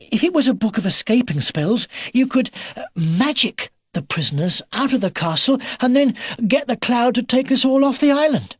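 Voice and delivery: put-on high-pitched, lively boy hero voice; young Philip, eager. Philip proposing an escape plan to Mister Edgar, eager.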